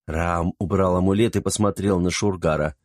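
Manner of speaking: ordinary delivery, nothing marked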